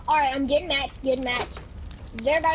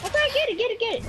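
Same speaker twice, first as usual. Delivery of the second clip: yelling from the sidelines; 7 semitones higher, much faster than usual. Real, not acted. Cheering teammate on to loot.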